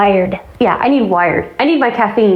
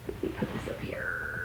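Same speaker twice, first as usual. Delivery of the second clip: barely audible whisper, much softer; 22 dB quieter than usual. Real, not acted. To herself while putting the cup up, whispered. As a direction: breathe it to yourself. Muttering while setting things down.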